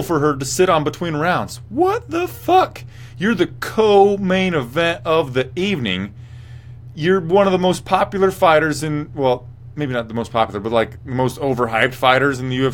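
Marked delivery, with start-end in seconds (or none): 1.7-9.1 high, slow, outraged squeal; incredulous rant pitched way up; exasperated. Real, not acted. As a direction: stretch it out high in disbelief. Ranting about the missing stool.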